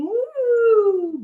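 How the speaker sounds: high, swooping woo; bouncing whoop. Acted. Bear flies off the trampoline, high swooping whoop. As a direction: whoop up and slide down.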